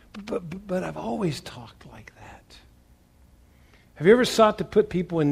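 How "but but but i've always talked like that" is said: hushed, breathy mock protest of a sinner; whispered excuse.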